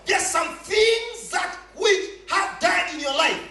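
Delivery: impassioned sermon, punching key words; full-force preaching. Real, not acted. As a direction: proclaim it hard to the congregation.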